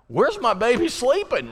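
high, loud fretting cry; worried parent. Retold or imitated. Worried parent wondering where her boy slept, high and fretful. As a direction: squeal it like a panicked mom. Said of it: "I'm just like".

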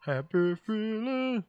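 high, slow sing-song. Pretend. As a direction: stretch it out sweetly. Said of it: "to change the mood".

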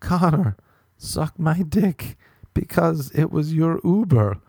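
high, put-on explaining voice; hammed-up foreign friend, slow and high; imitated Spanish friend. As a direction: mimic a friend earnestly explaining a joke. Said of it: "And he was like".

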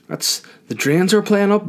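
high, prim mock voice; prissy high voice. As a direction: play the fussy neighbour complaining. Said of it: "when anyone goes".

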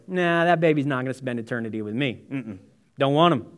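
low, fast, dismissive voice of God; mock dismissive God. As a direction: toss it off like a bored judge turning a baby away. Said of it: "looked at you the day you were born and said".

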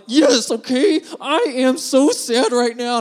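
loud, high-pitched tearful confession; mock bawling. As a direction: cave in with a hammed-up wail. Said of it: "bawling my eyes out".

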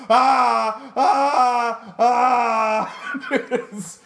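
loud, high drawn-out "ah" screams; stoned wailing. Retold or imitated. Stoned band wailing into the microphone, loud imitation. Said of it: "them naked in front of the microphone going".

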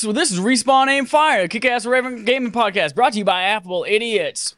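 high, sing-song fake country host accent; put-on howdy accent. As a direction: open the show in a hammed-up howdy accent. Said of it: "not my real accent".

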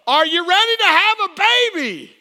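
imitated loud, high holler; big-lunged doctor shout. Doctor rallying the mother in labor, imitated shout. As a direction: holler the question at full lung power. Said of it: "man, she had lungs".

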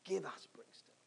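hushed, whispery plea to God; quiet, earnest petition; retold prayer. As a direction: pray it very softly, almost whispering. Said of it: "I was praying".

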